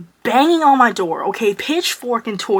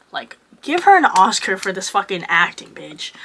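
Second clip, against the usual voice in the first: ordinary talk, nothing marked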